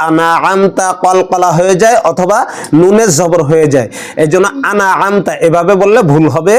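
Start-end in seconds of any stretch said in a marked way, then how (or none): none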